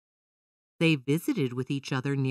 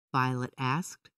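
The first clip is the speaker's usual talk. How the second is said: ordinary delivery, nothing marked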